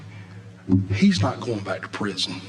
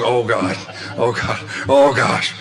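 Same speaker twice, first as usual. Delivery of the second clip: mock groaning dismay, growing louder; retold dismay; 3 dB louder than usual. Ex-prisoner realises he's facing the parole head, retold dismay. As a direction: imitate a man's mounting "oh no". Man's dismay at learning speaker's job.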